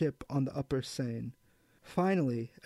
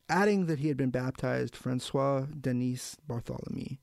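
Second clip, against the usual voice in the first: ordinary delivery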